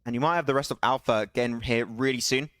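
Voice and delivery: energetic esports caster calling the action; live play-by-play. Acted. Caster calling Svan's clutch for stream viewers, live play-by-play. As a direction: narrate the fight as it happens, rising at the clutch. Casting a battle royale match.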